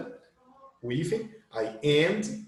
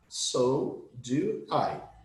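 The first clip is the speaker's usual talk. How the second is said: slow demo phrase; 4 dB quieter, much slower than usual. Shown as a demonstration. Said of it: "uma segunda forma".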